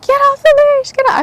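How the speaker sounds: high-pitched, loud mock yell; retold yelling. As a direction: yell it way up high, imitating your frantic self. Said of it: "yelling at him".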